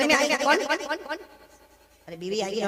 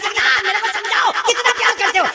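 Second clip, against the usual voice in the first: higher, insistent wife voice; pestering wife; 9 semitones higher, 5 dB louder than usual. Retold or imitated. Preacher voicing the wife who keeps asking how much he loves her. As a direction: coax an answer out of him, pitched up.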